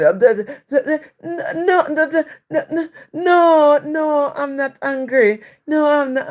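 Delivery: high, flustered stammer; tongue-tied imitation. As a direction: imitate a confused worker stuttering out a denial. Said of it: "tied tongue".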